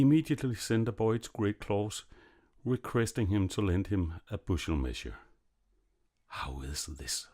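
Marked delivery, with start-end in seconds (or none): 6.3-7.3 soft, slow, lower to himself; muttered thought. A narrator's reading. Great Claus puzzling over Little Claus alive, soft muttered thought. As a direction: wonder it quietly to yourself. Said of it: "thought Great Claus".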